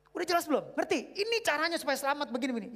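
high, loud, pushing the point; insistent high-pitched questioning; eager explaining. Retold or imitated. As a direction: press him to understand, fast. Preacher acts Paul Washer explaining.

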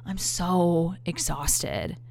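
whispered, slow fake tiredness; faked exhaustion. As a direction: play it drained to get out of the date. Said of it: "put on my best acting chops".

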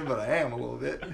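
usual speaking voice

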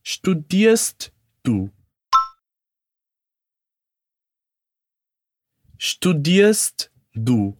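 "studierst" slowly, each sound clear with sht and final st; careful pronunciation. Shown as a demonstration. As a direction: stress "studierst" slowly and crisply. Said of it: "focando na pronúncia do studierst". ("studierst" pronunciado devagar e com clareza)

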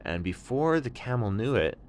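ordinary delivery, nothing marked

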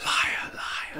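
a sly whispered jab; teasing whisper; whispered tease. Real, not acted.

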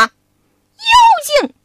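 high, loud battle cry; Monkey King shouting at the demon; furious shout. Acted. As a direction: yell it as you strike the demon. Wukong attacks the demon.

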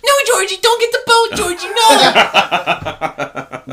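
very high, loud hammed-up screaming; mock panicked shriek. Moviegoers warning Georgie on screen, hammed-up panicked shriek.

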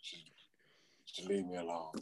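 exhausted player begging to be left alone, very soft; quiet plea. Retold or imitated. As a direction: fade to almost nothing. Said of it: "I was just like".

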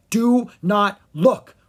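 slow, loud command; emphatic warning. A narrator's reading. Bard covers the prince's eyes, warning emphatically. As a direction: stress each word firmly. Urgent warning beside the monster.

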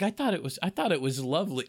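mock a would-be actress defending herself; rejected auditioning girl. Playing the girl thrown out of audition, light mock voice. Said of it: "She's like".

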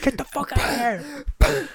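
hammed-up angry dad; mock yell. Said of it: "drop kicks him".

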